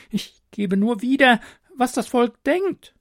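nervous royal adviser. A narrator's reading. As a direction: excuse yourself meekly. Adviser voiced by narrator.

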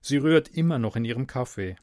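plain reading voice

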